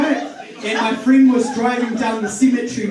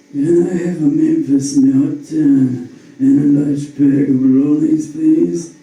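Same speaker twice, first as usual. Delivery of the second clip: low, slow, louder dopey voice; slow regular customer; 6 semitones lower, 7 dB louder, slower than usual. Retold or imitated. Regular customer at the night pay, slow low dopey voice. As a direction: play the dim regular ordering. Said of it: "he'd be like".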